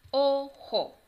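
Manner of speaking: put-on teacher segmenting "Ojo" for children; syllable by syllable. Shown as a demonstration. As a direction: break "Ojo" into separate syllables. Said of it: "vamos a separar cada una".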